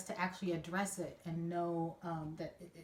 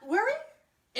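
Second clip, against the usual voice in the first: surprised; 9 dB louder than usual. Real, not acted. Doubting the shared baptism memory.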